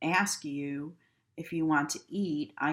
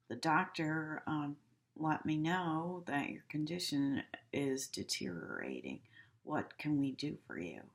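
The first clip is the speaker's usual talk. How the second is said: softer and gentle; concerned; 5 dB quieter than usual. Shown as a demonstration. Nurse gently telling a patient bad news, softer. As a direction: break the news with care. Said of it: "That shows much more concern".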